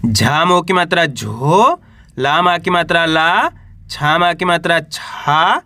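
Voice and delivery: each syllable drawn out slowly; slow spelling out. Shown as a demonstration. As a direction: sound out every letter slowly for a child. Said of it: "और पा को मिलाएंगे तो झोला छाप". (धीरे धीरे अक्षर जोड़कर बोलना)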